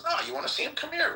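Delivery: high falsetto playing the woman; mock woman's voice. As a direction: squeak it up like the lady in the van. Voicing the woman offering from the van.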